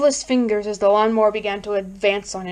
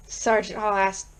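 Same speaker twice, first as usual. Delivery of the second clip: usual speaking voice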